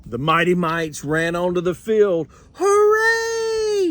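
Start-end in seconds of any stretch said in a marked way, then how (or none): 2.5-3.9 very high, louder hooray; fans cheering. Acted. Fans cheer the Mighty Mites taking the field, very high. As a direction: roar as the team runs out. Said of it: "shouted the fans".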